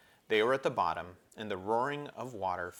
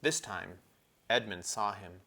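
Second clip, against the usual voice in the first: ordinary delivery, nothing marked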